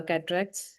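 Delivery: normal talking voice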